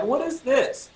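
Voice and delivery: high mock-offended question; acted-out bewilderment, pitch jumping up; incredulous. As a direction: ask it like it's unfair. Incredulous as band laughs.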